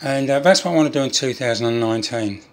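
ordinary talk, nothing marked

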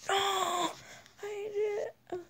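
high triumphant cry; excited cry. Real, not acted. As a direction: cheer it out in triumph. Triumph after a kill.

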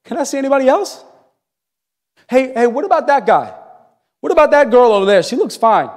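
high, fast, restless asking around; distracted seeker. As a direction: keep looking past him, impatient. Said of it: "And we're going".

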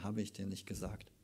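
soft, hushed gentle question; tender. Retold or imitated. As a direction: say it softly, close to her. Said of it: "das ist auch ganz süß, wie er zu ihr spricht".

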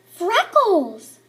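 loud, high, dramatic reveal of Freckles; dramatic exclaim. A narrator's reading. Narrator to listeners, announcing the horror with a loud cry. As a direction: belt it out like a big shocking secret.

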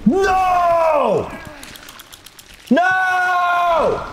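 long wailed "no"; gamer's howl of defeat; anguished shout. Real, not acted. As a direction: cry out in drawn-out dismay. Player fails in the game.

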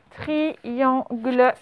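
careful syllable-by-syllable dictation; slow dictation. Shown as a demonstration. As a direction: split into separate, lengthened syllables. Repeats the dictated word for pupils.

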